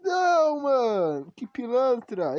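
high loud outraged cry. Real, not acted. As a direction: shout in disbelief. Creature escapes, outrage.

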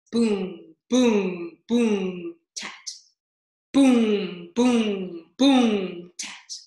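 loud booming drum imitation; ominous drumbeat. A narrator's reading. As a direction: boom out each beat like a drum. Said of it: "an ominous sound that went something like this".

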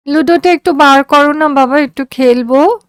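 put-on high, pleading girl's voice; a sick child asking to play; coaxing daughter. A narrator's reading. Daughter asking father for the ludo board, high and coaxing.

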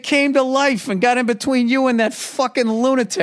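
high-pitched comic outburst; excited outburst. Real, not acted. Co-host cutting in on her story, high excited interjection. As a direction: burst in over the other person, pitch way up.

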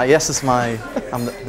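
ordinary talk

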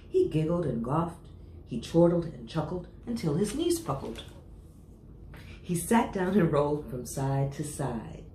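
ordinary delivery

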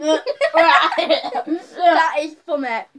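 slow words through laughter; laughing. Real, not acted. Tasting the vomit bean.

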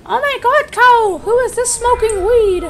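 very high, loud squeaky mock mom; over-the-top falsetto parent imitation; mom's outburst. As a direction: squeal like a scandalized mother. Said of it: "that's basically how the conversation went".

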